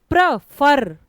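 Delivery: प्र, फर split in two, फर hit loud; stress on second syllable. Shown as a demonstration. Teacher showing where stress falls in प्र, फर, stressed second syllable. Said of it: "बाद वाले syllable पर जा रहा है".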